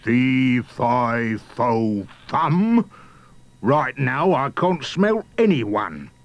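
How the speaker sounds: lightly voiced giant's chant, slowing at the end; drawn-out giant's complaint; loud muttering giant. A narrator's reading. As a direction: grumble loudly like an ogre. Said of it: "muttering loud".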